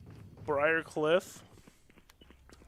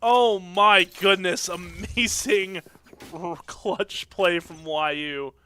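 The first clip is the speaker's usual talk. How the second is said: excited; 3 semitones higher, 9 dB louder, much slower than usual. Real, not acted. Caster still hyped.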